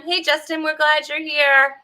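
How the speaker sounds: ordinary delivery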